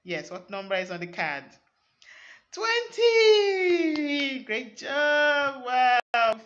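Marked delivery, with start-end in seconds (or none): 2.5-4.4 big triumphant shout of the number; excited shout. Acted. Kids' counting reaches final number. 4.4-6.4 excited praise. Acted. Praising children after finishing.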